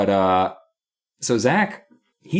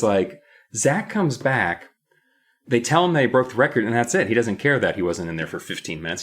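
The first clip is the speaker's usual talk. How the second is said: ordinary talk, nothing marked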